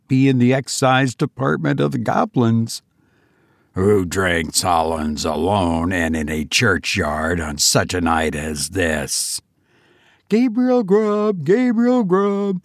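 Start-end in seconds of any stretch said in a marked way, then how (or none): 3.7-9.4 very low, menacing goblin voice; accusing goblin. A narrator's reading. Goblin questioning the lone drinker, deep and accusing. 10.3-12.6 high, drawn-out eerie chant; wild screaming chorus. A narrator's reading. Goblin voices filling the churchyard, chanting high. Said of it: "exclaimed the wild voices again".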